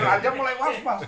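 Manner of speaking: loud high laughter then words; can't stop laughing. Real, not acted. Laughs telling the joke.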